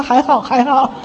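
loud, high, laughing retelling; amused disbelief. Retold or imitated. Sister baffled that people in Taiwan say they are not fine, laughing exclamation. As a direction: crack up while recounting the odd replies.

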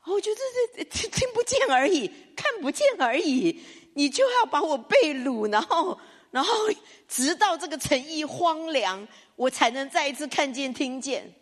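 mock the people grumbling at God; whining complaint. People complaining to God, high mock whine.

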